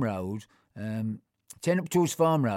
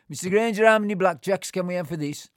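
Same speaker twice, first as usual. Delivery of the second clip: calling out to shopkeeper; 6 semitones higher, 7 dB louder than usual. Retold or imitated.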